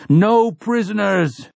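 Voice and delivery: high, slow war scream. A narrator's reading. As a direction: drag out the scream. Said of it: "Roland screams".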